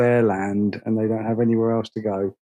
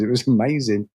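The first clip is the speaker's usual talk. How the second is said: ordinary talk, nothing marked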